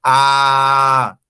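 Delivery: loud, long-held A; classmate's wrong vowel. Acted. Classmate pronouncing the wrong vowel for I, loud held A. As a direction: belt out A, steady and drawn out. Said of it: "Y el compañero pronuncia".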